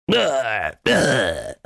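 two drawn-out mock grunts; imitated grunt yells. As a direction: grunt twice like men in a scuffle. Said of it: "grunt yelling".

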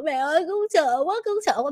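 put-on a frightened girl near tears; a lightly voiced crying daughter; scared crying child. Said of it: "nước mắt bà vàng giữa".

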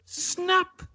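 shrill-free high squeal of snap; mock Amanda Holden snap call. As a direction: yelp it out, way up high.

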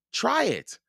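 put-on high-pitched, eager child; eager urging. A narrator's reading. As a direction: urge a friend in a high voice. Girl urges friend to taste.

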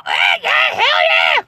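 whooping falsetto hillbilly holler; high-pitched yelling. Retold or imitated. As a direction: yell it way up high. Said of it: "such a high-pitched fucking ordeal".

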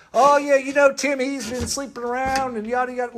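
high-pitched mock Southern gossip; Southern accent. Preacher mimics someone passing the sermon on, in a Southern accent. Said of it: "why do I get a Southern accent".

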